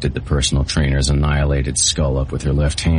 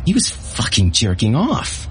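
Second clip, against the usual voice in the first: put-on frantic girl; 8 semitones higher than usual. A narrator's reading. Bate frantic in story.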